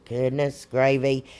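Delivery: usual speaking voice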